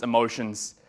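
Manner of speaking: usual speaking voice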